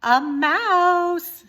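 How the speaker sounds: high, loud, sure correction; insistent princess. Acted. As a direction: insist like a little girl who knows she's right. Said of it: "said the Pea, who knew that she was right".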